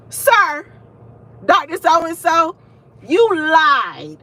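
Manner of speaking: high, loud mock outrage; mock accusation. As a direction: call out the professor, hammed-up. Mock call-out of lying professor.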